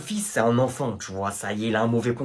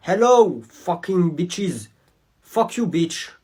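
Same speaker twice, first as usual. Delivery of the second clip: 1 slow mock insult; much slower than usual. Pupil's broken English insult.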